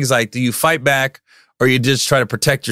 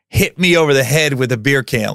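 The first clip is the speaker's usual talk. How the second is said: usual speaking voice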